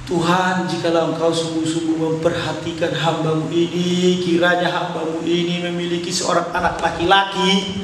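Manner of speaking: a high, half-sung plea to God, a touch of weeping; tearful prayer. A narrator's reading. Hana pleading to God in the temple, tearful and half-sung. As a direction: pour it out like a broken-hearted woman praying. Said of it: "dia menangis terseduh-seduh".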